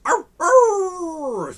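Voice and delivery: high, loud yapping bark; dog barking. Acted. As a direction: bark like a nervous little dog. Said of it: "barked the dog".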